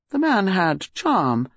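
ordinary delivery

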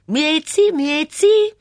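high, loud, slow mimicking call of her name; mocking call. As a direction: ape the nagging lady calling her. Mitzi mimics Miss Bunner calling.

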